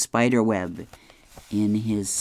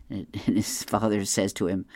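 ordinary delivery

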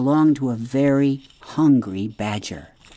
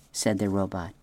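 plain reading voice

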